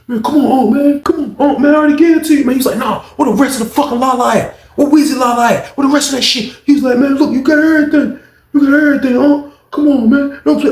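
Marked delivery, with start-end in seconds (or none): 0.1-2.5 high, strained begging; desperate pleading. Retold or imitated. Beaten man begging Unc to stop, high desperate pleading. 2.8-6.5 high, heated questioning; angry demand. Retold or imitated. Unc demanding the missing drugs, high angry voice. 7.0-10.7 high, strained begging; desperate pleading. Retold or imitated. Man on the floor begging Unc to believe him, high pleading.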